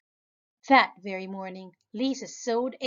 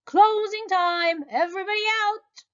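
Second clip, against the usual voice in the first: manager calling much higher, loud; 12 semitones higher, 6 dB louder than usual. Acted. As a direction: call out closing time across the room. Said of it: "the manager of the laundromat called".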